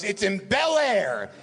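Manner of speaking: high, long-drawn mock insistence; Mel Brooks. Mel repeating his one clue to the assistant, hammed-up insistence. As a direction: imitate exasperation, stretching the place name. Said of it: "he goes".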